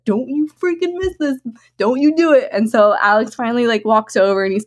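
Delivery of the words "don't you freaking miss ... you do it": self pep talk. Retold or imitated. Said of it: "but I was like".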